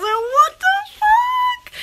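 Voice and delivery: very high, drawn-out disbelief; shocked outburst. Retold or imitated. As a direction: recoil in disgust. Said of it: "I was like".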